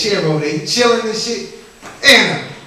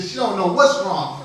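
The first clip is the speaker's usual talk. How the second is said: ordinary talk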